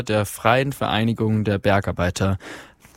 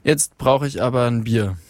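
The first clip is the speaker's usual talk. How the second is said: plain reading voice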